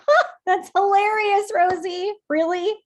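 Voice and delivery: high, loud mocking laugh-talk; sarcastic laughing. Negative inner voice mocking Rosie, sarcastic and loud. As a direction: jeer at Rosie with a scornful cackle. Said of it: "it's like the sarcastic laughing".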